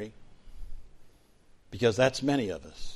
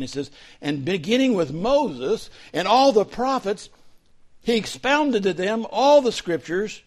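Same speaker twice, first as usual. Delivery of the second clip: higher, louder, driving sermon voice; full-force preaching; 9 semitones higher, 9 dB louder than usual. Real, not acted. Pastor preaching to the congregation at full force. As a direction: preach it out with fire to the room.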